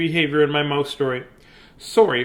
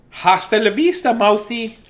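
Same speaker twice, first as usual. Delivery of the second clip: higher, louder cheeky cat sign-off; pitched-up villain cat farewell; Chester the cat voice; 7 semitones higher, 5 dB louder than usual. Pretend. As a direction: gloat like a smug cat waving goodbye. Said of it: "Chester needs a voice".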